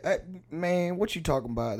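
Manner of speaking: high-starting Louisiana protest; Louisiana guy. Retold or imitated. Co-host showing how to excuse the voice, Louisiana accent. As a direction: imitate an indignant Louisiana man. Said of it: "just say you're doing a guy from Louisiana".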